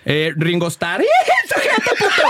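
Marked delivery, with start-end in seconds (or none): 1.3-2.2 much higher, laughing triumphant yell; laughing victory shout; gloating shout. Real, not acted. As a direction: crow over the win. Gloating after naming all Beatles.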